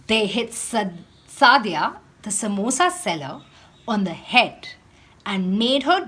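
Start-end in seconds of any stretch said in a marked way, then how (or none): none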